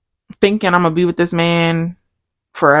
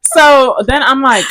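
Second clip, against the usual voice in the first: ordinary talk, nothing marked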